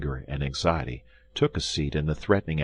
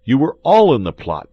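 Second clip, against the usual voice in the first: much higher, louder fury; angry accusation; 9 semitones higher, 12 dB louder than usual. Acted. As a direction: throw the accusation in his face. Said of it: "cried D'Artagnan".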